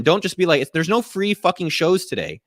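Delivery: ordinary delivery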